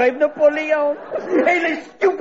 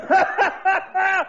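scoffing laugh. Acted. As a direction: laugh the idea off. French guest scoffs at British culture.

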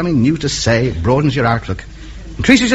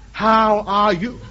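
slow rephrase; much slower than usual. Shown as a demonstration. Ham radio man to Tokyo, retrying slowly. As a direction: try again, slower and gentler. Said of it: "No, no".

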